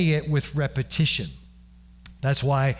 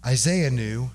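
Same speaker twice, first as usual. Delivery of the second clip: ordinary delivery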